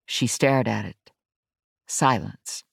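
ordinary delivery, nothing marked

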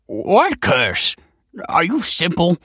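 high, loud indignant yelps; flustered, drawn-out scholarly protest; yelping scholar. A narrator's reading. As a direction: yelp it while struggling to get free. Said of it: "yelped the scholar, wriggling".

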